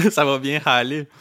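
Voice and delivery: laughing through the pun. Real, not acted. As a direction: chuckle the slogan out loud.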